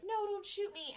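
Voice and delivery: high-pitched mock plea; mock pleading. Ghost pleading before being shot.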